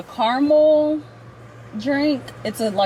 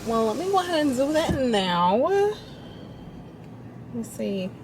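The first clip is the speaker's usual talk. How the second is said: usual speaking voice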